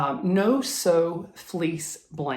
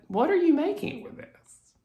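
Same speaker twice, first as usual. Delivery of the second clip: high-pitched Southern shop woman; Southern accent; 12 semitones higher than usual. Retold or imitated. Woman at the cutting counter questioning a customer, Southern accent. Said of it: "I'm instantly going into a Southern voice".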